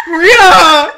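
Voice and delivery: very loud, high comic shout of a name; yelling. Retold or imitated. Last attempt to wake her friend, yelling. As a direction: bellow it to wake the dead asleep. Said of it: "I was yelling".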